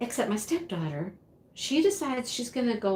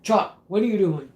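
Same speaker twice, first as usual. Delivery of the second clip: louder retold scolding shout; mock holler; 7 dB louder than usual. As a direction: holler it like calling out a misbehaving rooster. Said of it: "He's hollering at him".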